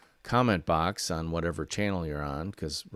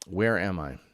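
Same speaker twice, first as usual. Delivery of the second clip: normal talking voice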